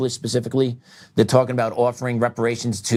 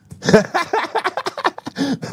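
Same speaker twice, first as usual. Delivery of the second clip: high laugh. Real, not acted. Laughs at own God remark.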